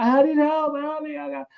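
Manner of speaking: imitating a high, desperate plea for help; acted panic. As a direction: beg the doctor like you're doubled up in pain. Retelling an ER visit in agony.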